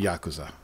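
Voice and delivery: Yakuza with YAK as the first syllable; corrected pronunciation. Shown as a demonstration. Correcting how co-hosts say the game title, Yakuza as YAK-uza. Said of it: "That's how you pronounce it".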